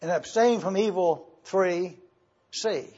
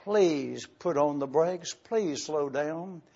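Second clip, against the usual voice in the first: slowing and fading, a mild mock plea; pleading to slow down; 2 semitones lower, 4 dB quieter, slower than usual. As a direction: ease off and trail away, gently imitating the congregation. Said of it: "because so many of you said".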